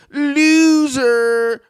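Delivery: hammed-up announcing of an insult; mocking emphasis. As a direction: stretch the word out like a taunt. Mocking the title he reads.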